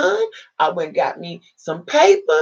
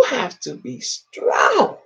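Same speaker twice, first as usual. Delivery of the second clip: tearful, slowed, pitched up; crying; 6 semitones higher, much slower than usual. Real, not acted. Mother recalling her son, near tears. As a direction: voice breaking on strong.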